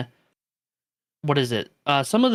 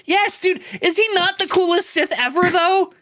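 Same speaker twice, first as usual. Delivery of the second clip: excited; 4 dB louder than usual. Real, not acted. Gushing about favorite villain.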